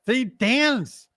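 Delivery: usual speaking voice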